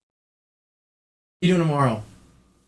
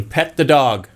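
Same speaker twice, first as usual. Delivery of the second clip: ordinary delivery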